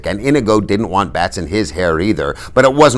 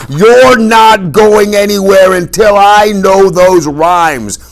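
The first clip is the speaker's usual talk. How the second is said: furious high yell, slow and loud; drawn-out angry shout; Fezzik yelling out; 13 semitones higher, 10 dB louder, slower than usual. A narrator's reading. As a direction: bellow it out slowly at full force. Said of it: "now he was yelling out".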